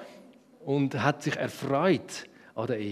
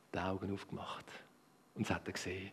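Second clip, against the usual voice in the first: gehaucht und tief, voller Spannung; flüstere, als ob gleich etwas Wunderbares kommt; Flüstern; 7 semitones lower, 15 dB quieter than usual. A narrator's reading. Adam erwacht, Spannung beim Erzählen. (Erzähler flüstert, wie Adam die Augen öffnet)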